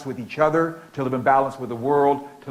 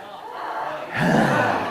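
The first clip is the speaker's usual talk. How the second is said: disgusted sigh. Shown as a demonstration. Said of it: "act like you're disgusted and just upset".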